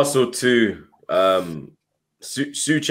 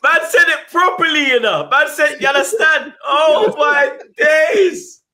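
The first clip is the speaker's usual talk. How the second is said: excited shouting. Real, not acted. Friend hyping up his mate's catchphrase, shouting. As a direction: stretch out the last line, howling with delight. Hyped by the co-host's line.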